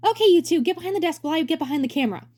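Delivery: bright high showman voice; high cheery director voice. Acted. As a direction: play the grinning director, way up high.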